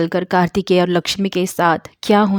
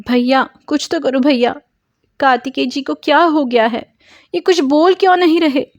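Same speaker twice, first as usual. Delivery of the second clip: higher, rising to a loud frantic cry; panicked pleading; 7 semitones higher, 4 dB louder than usual. Acted. Lakshmi begs Murthy to help her husband, frantic and high. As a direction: beg for help, growing more desperate.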